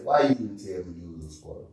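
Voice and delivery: low, soft, quick ask; a hushed, hurried question. Retold or imitated. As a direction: ask it under your breath. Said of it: "I'm gonna say".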